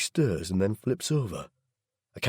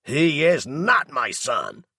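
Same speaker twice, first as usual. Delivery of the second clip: higher, louder, slow furious yell; 7 semitones higher, 5 dB louder, much slower than usual. A narrator's reading. Daryl yells so Noah can hear, furious yell. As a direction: yell it so the whole house hears. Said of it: "he yells".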